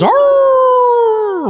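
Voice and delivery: very high wild yell; battle roar. Acted. Pillow man roaring before battle, a wild high yell. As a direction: let out a piercing war cry. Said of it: "shit fuck goes".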